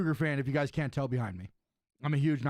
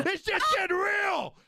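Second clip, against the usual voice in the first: excited shout; much slower than usual. Real, not acted. Excited reaction to a proposal.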